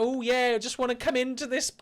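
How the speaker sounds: high and loud, camped-up plea to get in; camp character voice. Pretend. Bouncer asking to enter, camp and high. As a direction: ham up a camp clubgoer, way up high. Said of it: "my character was that I was just a bit camp".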